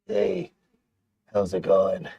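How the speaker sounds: Muppet tenant voice. Shown as a demonstration. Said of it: "Show me the tenant who murders the landlord as a Muppet".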